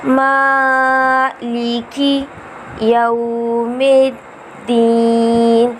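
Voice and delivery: drawn-out tajweed chant of the verse; slow Quran recitation. Shown as a demonstration. Teacher recites Fatiha for students, slow chant. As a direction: recite each word slowly and melodically.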